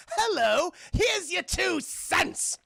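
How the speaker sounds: high, loud, slow villain voice; Joker. Acted. Throwing pennies at Charlie in his high beams, gloating. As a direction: gloat theatrically, drawing out the last words. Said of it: "The Joker's like".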